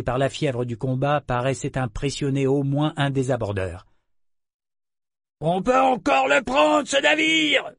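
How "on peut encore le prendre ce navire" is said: much higher, loud, rough pirate voice; growling pirate shout. Acted. Pirate on deck urging the boarders on, loud angry shout. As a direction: snarl it like a stubborn raider who won't quit. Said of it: "gronda un pirate".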